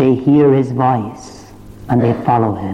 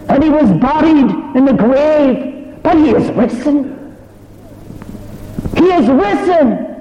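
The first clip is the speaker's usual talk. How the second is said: full-force preaching. Acted. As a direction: lift the whole room with the joy of it. Preacher rises into full proclamation.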